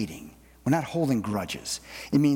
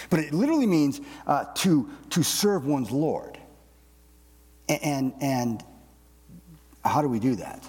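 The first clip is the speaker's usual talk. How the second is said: ordinary talk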